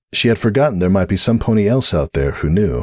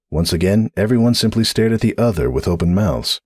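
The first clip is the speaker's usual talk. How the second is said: ordinary delivery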